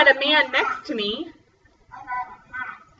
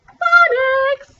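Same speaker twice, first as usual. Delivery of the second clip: excited announcement; 12 semitones higher, 4 dB louder than usual. Acted. Teacher announcing next activity.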